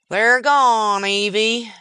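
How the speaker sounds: put-on loud, high old-timer cry; old prospector. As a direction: call out the loss like a lonely miner. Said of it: "he said to the burro".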